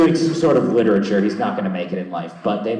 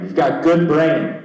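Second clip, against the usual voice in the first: doctor voice; 7 semitones higher, 4 dB louder, slower than usual. Retold or imitated. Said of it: "as the doctor would say".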